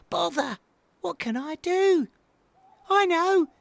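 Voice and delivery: high little owl, frustrated then inspired; cross little outburst, then a loud idea; high-pitched baby owl voice; thinking out loud. A narrator's reading. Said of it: "he thought out loud".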